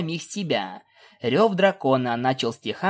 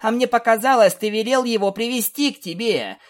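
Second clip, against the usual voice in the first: shouting back; 9 semitones higher, 3 dB louder than usual. Acted. Shouted argument with stallion.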